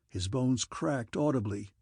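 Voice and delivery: plain reading voice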